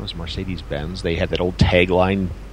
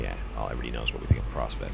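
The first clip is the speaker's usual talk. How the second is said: fast, whispered jab; whispered aside; 8 semitones lower, 10 dB quieter, much faster than usual. Real, not acted. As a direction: mutter it under your breath, quickly.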